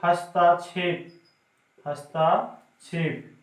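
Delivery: slow drawn-out. Shown as a demonstration. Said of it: "लोग बोलते हैं".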